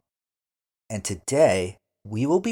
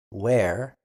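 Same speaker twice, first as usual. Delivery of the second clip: Where said alone, rising; Where with a rise at the end; model word; 5 dB louder than usual. Shown as a demonstration. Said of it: "asks about a place".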